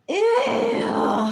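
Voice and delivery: high, loud squeal of disgust; high disgusted cry. Real, not acted. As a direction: shriek ew in revulsion. Reacting to being called slob.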